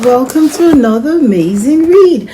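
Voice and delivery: loud, high and bubbly welcome; cheery greeting. Real, not acted. Host welcoming kids to story time, bright and loud.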